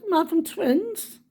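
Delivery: high-pitched news, rising at the end; imitated excitement. Granddaughter telling Nan about twins, imitated high excitement. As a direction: announce it high, ending on a lift.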